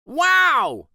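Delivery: high, loud excited yell; gleeful cheer of unruly kids. A narrator's reading. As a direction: shout it out with glee. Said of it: "yelled the kids".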